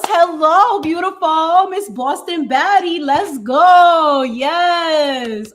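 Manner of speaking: high, loud cheering welcome; hype greeting. Real, not acted. Host cheering a viewer who just joined, loud excited greeting.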